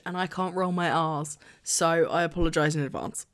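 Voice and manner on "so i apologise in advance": ordinary talk, nothing marked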